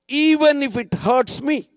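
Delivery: high, emphatic declaration of surrender; fervent prayer. Acted. Preacher praying thy will be done, fervently. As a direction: raise it up with conviction. Climax of his demonstrated prayer.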